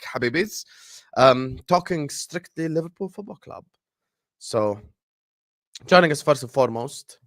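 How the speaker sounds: ordinary delivery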